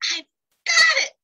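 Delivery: high, loud burst of discovery; fast, excited eureka cry; sudden idea. Acted. Little ogre hitting on his plan, excited shout.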